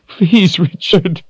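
high, anguished begging; crying plea. A narrator's reading. Kahlan falls against Richard, crying plea. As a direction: cry out the plea, pitched high. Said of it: "she cried".

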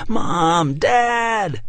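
put-on high, panicked child cries; children shouting. A narrator's reading. Kids shouting for their parents, panicked high cries. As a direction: cry out for the parents. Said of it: "began to shout".

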